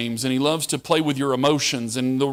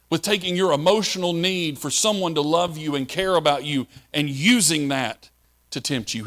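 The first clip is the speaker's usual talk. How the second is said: ordinary talk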